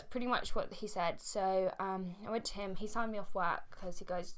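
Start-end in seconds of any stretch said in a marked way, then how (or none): none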